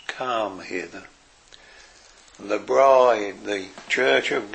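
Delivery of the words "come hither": hushed, soft come hither; softly whispered. Retold or imitated. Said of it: "will whisper this".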